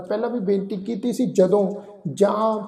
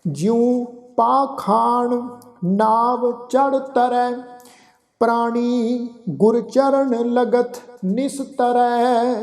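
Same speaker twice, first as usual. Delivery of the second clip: slow, devout chanting, each phrase drawn out; gurbani recitation; 3 semitones higher, much slower than usual. Acted. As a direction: chant the verse with reverence.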